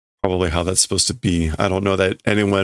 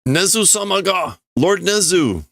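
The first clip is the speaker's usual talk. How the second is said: mock urgent report; 10 semitones higher than usual. Voicing guard reporting coup.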